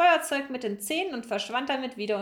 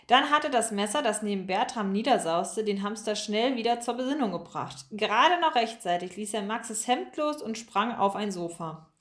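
usual speaking voice

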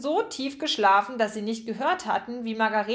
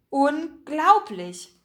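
very slow, higher-pitched astonishment; amazed; 4 semitones higher, 4 dB louder, much slower than usual. A narrator's reading. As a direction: stretch it out in sheer amazement. Man amazed by the jam.

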